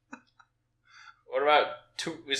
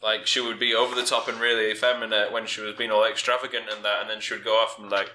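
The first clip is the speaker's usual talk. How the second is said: ordinary talk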